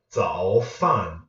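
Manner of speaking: "Zao" dipping low, "fan" falling; third plus fourth tone. Shown as a demonstration. Teacher giving viewers an example word, dip then fall. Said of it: "Third tone with the fourth tone".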